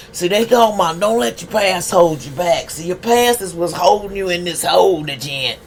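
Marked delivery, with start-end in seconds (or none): none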